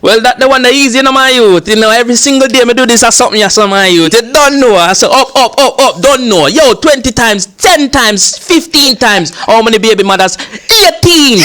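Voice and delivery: high, animated Jamaican patois; Jamaican accent. Pretend. Comedian performing on the host's request, in a Jamaican accent. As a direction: play up a boastful Jamaican man, pitched well up. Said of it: "Okay, Jamaican, Jamaican".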